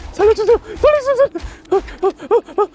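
high terrified cries for help; panicked screaming. Real, not acted. 쫓기며 도움 외침. (겁에 질려 살려달라는 비명)

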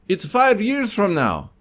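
high, emphatic Russian salesman; Russian accent. Retold or imitated. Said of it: "if you didn't like my Russian accent".

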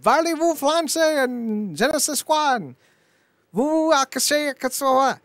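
high, slow hammed-up fake French; parodied French gibberish, pitched high; mock French. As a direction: ham up bad schoolboy French phrases. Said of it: "So they're like".

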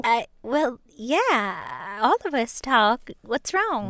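flirty Southern drawl; Southern accent. Pretend. Catnap sister flirts with T V Man, Southern accent. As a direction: sweet-talk him like a Southern belle. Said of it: "why you Southern?".

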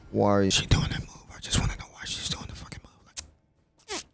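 unvoiced, fast whispered question. Real, not acted. Gamer whispering at the boss's move, baffled. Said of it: "whispered aside".

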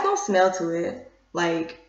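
speaking into a jar; muffled by held jar; jar held at mouth. Real, not acted. Seen 0: Sniffs jar pressed near nose and mouth while saying no smell.

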